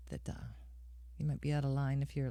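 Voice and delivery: ordinary talk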